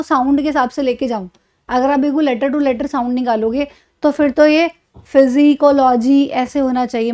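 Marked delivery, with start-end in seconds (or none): 5.1-6.2 "फिजिकोलॉजी" with the initial P sounded as F; letter-by-letter P. Shown as a demonstration. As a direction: misread "फिजिकोलॉजी" letter by letter, ending in full LO-JEE. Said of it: "पी की sound भी अगर लोगे".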